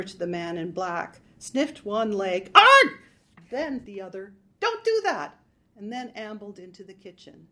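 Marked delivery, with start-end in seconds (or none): 2.5-3.0 high loud startled yelp. A narrator's reading. Burglar startled by dog. 4.6-5.4 high panicky protest. A narrator's reading. Burglar to sniffing dog.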